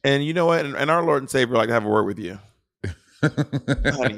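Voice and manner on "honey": laughing through "honey". Real, not acted. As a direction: chuckle the word out. Teasing sign-off, laughing.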